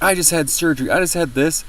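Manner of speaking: retold scream. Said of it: "she started screaming at me".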